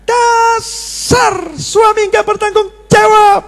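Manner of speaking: high drawn-out mock wife's shriek. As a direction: stretch it out in a shrill wife voice. Wife insulting husband.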